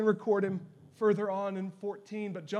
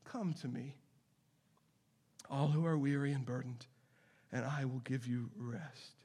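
soft, lower, gentle reading; tender invitation; 5 semitones lower, 6 dB quieter than usual. Acted. Preacher voicing Jesus' invitation, soft and tender. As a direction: offer it gently like comfort.

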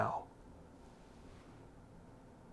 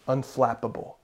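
Unflappable said low and soft; word drawn out; 3 semitones lower, 7 dB quieter than usual. Shown as a demonstration. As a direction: lay out the word unflappable slowly. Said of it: "where that word comes from".

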